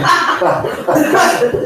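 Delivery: shrieking real laughter, high and loud. Real, not acted. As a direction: burst out laughing at the insult. Laughing at friend's insult.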